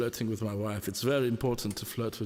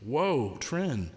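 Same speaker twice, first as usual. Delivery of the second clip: ordinary delivery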